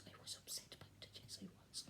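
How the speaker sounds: secretive whisper, breathless and low; hushed aside to the viewer; whispered confession. Acted. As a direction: lean in and whisper it like a guilty secret.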